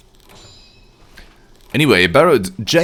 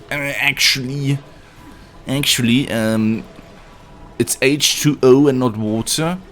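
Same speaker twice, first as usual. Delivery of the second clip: higher-pitched pedantic know-it-all, drawn-out "actually"; mock Redditor voice, pitched up; dumb Redditor; 4 semitones higher than usual. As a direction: correct them like a smug online pedant. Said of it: "like a dumb Redditor you see online".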